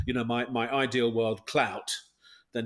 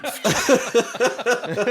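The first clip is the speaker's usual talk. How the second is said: loud laugh; 10 semitones higher, 8 dB louder than usual. Real, not acted. Laughing at own joke.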